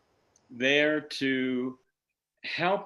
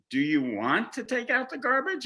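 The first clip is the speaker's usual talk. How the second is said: asked much higher, lilting; mock tentative question; 10 semitones higher than usual. Wife asking husband about garbage, higher questioning lilt. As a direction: dangle it as an option. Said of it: "But if you ask me".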